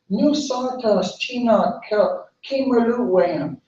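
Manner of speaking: high, slow refusal; lightly acted haughty tree, drawn out and high; dismissive dogwood. Dogwood tree turns the little bird away, mildly dismissive.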